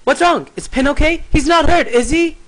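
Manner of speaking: high, loud, acted worry; alarmed character voice. Anxious character asking if Pin is hurt, alarmed. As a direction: fire off worried questions in a high put-on voice. Voicing a worried character's questions.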